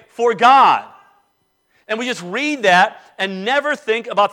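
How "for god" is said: "God" higher and much louder; slow down and land hard on "God"; stress on God. Shown as a demonstration. Preacher showing how to meditate, stress on "God". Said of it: "emphasize the words in the text".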